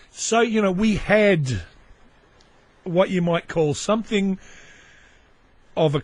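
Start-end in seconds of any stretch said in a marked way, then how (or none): none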